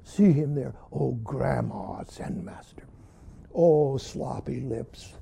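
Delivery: low, slow, hushed retelling; imitating a moved teacher. Teacher in tears narrating the old master's combat, imitated in a low hush. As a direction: drop low and savor each phrase, almost whispering in places. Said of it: "And then Roshi says".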